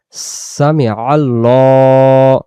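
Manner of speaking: very slow, drawn "সামি আল্লাহ"; slow recitation. Shown as a demonstration. Teacher demonstrating the standing tasbih. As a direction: draw out "সামি আল্লাহ" with a held l. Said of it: "ল পরে উচ্চারণ করতে হয়".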